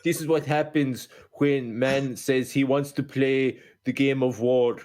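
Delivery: put-on slow, halting Polish accent; ponderous mock-Polish delivery with drawn-out words. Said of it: "a better Polish accent".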